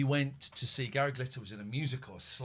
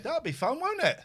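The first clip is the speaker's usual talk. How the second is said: sing-song, much higher pet talk; high cooing; 6 dB louder than usual. Real, not acted. Owner coaxing the cat before bandaging, high cooing.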